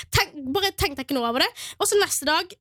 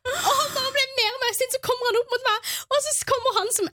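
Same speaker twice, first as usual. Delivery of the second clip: ordinary talk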